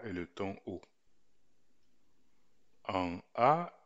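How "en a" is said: "A" held on a mid level tone, a little raised and louder. Shown as a demonstration. Teacher demonstrating the mid tone on "A". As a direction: sing "A" on a steady mid pitch. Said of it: "il donne quelque chose comme ceci".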